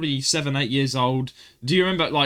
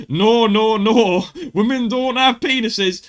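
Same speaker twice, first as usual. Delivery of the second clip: high, slow, amused correction; amused teacher; 8 semitones higher, 5 dB louder, slower than usual. Retold or imitated. Teacher correcting the confused pupil, amused, high and slow. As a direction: gently put him right while laughing. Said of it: "He was like, laughs".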